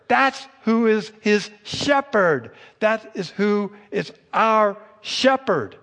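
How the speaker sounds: preacher's crescendo on who the shepherd is; full-force preaching. Acted. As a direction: proclaim it to the whole congregation. Preaching climax on God's greatness.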